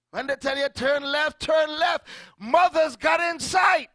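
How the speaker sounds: high, loud shouted preaching; preaching at full force. Acted. Preacher shouting to congregation about mothers. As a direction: drive the point home.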